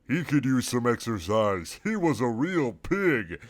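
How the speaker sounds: slow sassy child voicing; drawn-out sassy remark; sassy girl voice. Alana's voice, slow.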